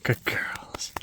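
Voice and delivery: soft whisper to the dogs; whispered praise. Real, not acted. Whispering praise to her dogs. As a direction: breathe it, barely voiced.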